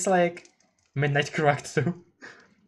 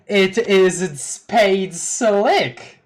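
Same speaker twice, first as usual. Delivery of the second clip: slow, loud gruff gangster voice; Spade Slick voice; 5 semitones higher, 6 dB louder, much slower than usual. Acted. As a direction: drag out the name like a tough crook. Introducing Spade Slick character voice.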